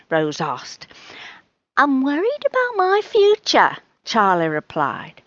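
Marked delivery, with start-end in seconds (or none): 1.8-3.8 high-pitched little cat voice; worried cat. Acted. Charlie tells Rose why he came, high worried voice. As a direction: confide a worry in a high voice.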